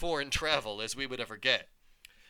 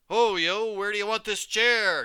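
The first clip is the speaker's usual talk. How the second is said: high, loud call from the doorway; 9 semitones higher, 9 dB louder than usual. A narrator's reading. Mover John calling from the doorway, loud. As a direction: holler across the room. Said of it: "John appeared in the doorway".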